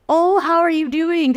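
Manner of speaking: high, bright and drawn-out hello; cheery cashier greeting. Retold or imitated. Cashier warmly greeting the next customer, high and slow. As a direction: sing it out like a chirpy cashier.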